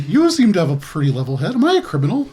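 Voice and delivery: high, questioning character voice; simple character's rising question; Glub asking innocently. Acted. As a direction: lift it high, naively puzzled. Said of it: "He turns to you".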